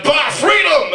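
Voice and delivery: high mock-Scottish rallying shout; Alex Salmond. Bold Scottish politician rallying his followers, high mock shout. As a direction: cry it out as a bold Scottish rebel. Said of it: "this bold Scottish dude was going".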